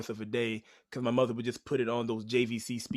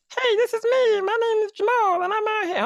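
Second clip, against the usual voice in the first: squeaky, much higher and louder tape-recorder voice; chipmunk voice; 10 dB louder than usual. Retold or imitated. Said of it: "that sound like Alvin and the Chipmunks".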